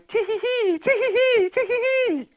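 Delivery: very high, gleeful giggle; little boy giggling. Acted. Happy boy giggles while digging in the manure, very high. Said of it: "a little boy's voice going".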